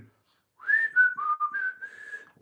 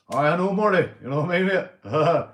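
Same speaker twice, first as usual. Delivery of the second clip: loud reply; 5 semitones higher, 7 dB louder than usual. Real, not acted. Replying loudly to chat.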